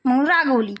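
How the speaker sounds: usual speaking voice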